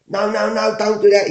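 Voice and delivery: imitating a rattled store manager begging; panicky manager. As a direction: beg him not to come in, flustered. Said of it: "he said".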